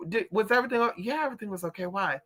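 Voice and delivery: ordinary talk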